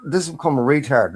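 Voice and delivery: ordinary delivery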